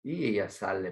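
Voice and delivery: ordinary delivery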